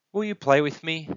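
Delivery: put-on high child's voice asking; small, high-pitched question; hopeful little one. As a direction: plead lightly for a playmate. Lonely little one asks for playmate.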